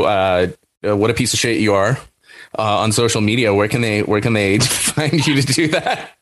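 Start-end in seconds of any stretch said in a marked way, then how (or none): 4.6-6.1 cracking up mid-line. Real, not acted. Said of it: "I'm laughing at my own joke too hard".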